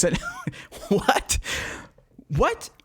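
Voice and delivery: can't believe what he just heard; incredulous what. Real, not acted. Disbelief at Rumsfeld's answer.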